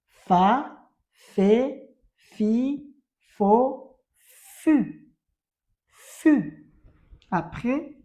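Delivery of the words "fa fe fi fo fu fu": fa to fu called out loud; teacher drilling syllables aloud. Shown as a demonstration. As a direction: chant the row loudly. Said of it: "teacher drilling syllables".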